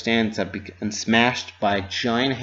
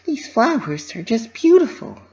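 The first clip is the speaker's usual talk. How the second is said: high, bouncy cartoon pony voice; Pinkie Pie voice; 11 semitones higher than usual. A narrator's reading. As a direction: voice a cheerful pony fretting to herself.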